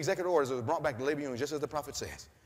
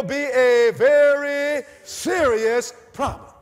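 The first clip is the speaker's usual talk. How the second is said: building loud, high and slow to the end; preaching; 4 semitones higher, 8 dB louder than usual. Acted. Preacher warning the church about buying and selling, building loud. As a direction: build and slow right down for the last words.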